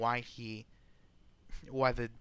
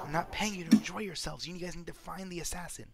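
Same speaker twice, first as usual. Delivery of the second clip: higher-pitched in-character scolding; stern woman employer; 6 semitones higher, 3 dB louder than usual. Acted. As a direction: tell off your hired hands in a raised pitch. Game master plays Marin, scolding the party.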